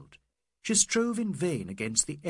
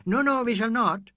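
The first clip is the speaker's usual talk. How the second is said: higher and louder denial; loud insistence; 9 semitones higher, 8 dB louder than usual. A narrator's reading. Mr Bhattacharya insisting to the ladies, loud. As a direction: insist brightly, loudly.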